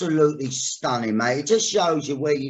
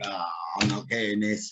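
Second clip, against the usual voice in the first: resigned; 8 semitones lower, 8 dB quieter than usual. Retold or imitated. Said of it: "I think".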